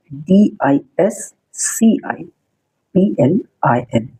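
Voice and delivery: very slow, spaced letters; slow dictation of the spelling. Shown as a demonstration. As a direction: spell it out slowly for students to copy. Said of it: "teacher spells slowly".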